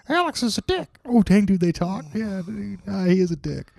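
high cartoon Mickey Mouse dog voice; talking dog. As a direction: squeak out the lines as the dog. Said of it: "Your dog sounds just like Mickey Mouse".

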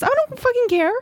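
high, squealing mock outrage; outraged retort. Hiker brushes off woman's reassurance, high mock outrage. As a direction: snap back in an exaggerated high voice. Acts out her outraged reply.

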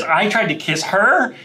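high, drawn-out incredulous quote; acted disbelief. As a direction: play it aghast, slowly. Acts out J D's astonished line.

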